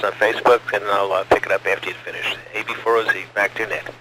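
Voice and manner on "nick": normal talking voice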